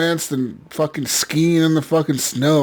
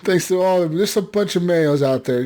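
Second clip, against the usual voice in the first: ordinary delivery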